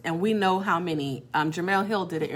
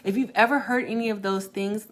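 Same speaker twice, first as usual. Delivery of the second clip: usual speaking voice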